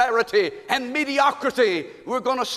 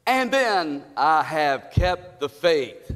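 slow solemn reading; 7 semitones lower, much slower than usual. Shown as a demonstration. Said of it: "Say it with me".

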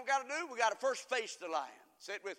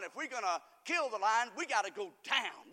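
ordinary delivery

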